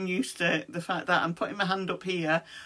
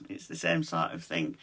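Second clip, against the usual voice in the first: ordinary talk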